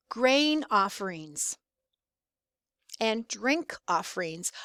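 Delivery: "Grain offerings" said slowly, then a long pause before "drink offerings"; stressed key words. Shown as a demonstration. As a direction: set off "Grain" and "drink" as clues with measured pauses. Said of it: "by my voice and the pauses".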